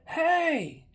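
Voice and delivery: high-pitched holler; retold screaming. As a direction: imitate calling out through an open front door. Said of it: "and I started screaming".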